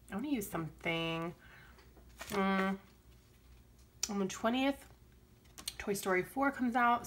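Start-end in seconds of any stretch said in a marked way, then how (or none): none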